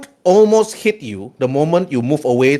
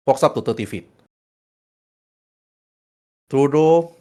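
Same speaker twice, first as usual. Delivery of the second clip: normal talking voice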